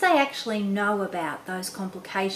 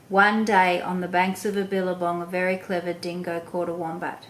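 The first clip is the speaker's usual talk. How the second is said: quick, flat, bored storytelling; fast monotone read. Shown as a demonstration. Parent showing the wrong way to read a story, rushed monotone. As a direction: rattle it off with no interest. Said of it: "I was too quick, I had a monotone voice".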